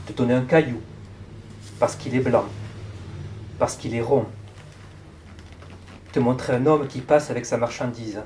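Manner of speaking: measured poem recital with long pauses; projected, deliberate reading of verse in short phrases; poetry reading. Acted. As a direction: read it line by line, letting each phrase hang. Reads a poem aloud.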